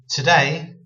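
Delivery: "today" as one word, dull short first vowel. Shown as a demonstration. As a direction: run "today" together, first O dulled. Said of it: "not saying it in the same way".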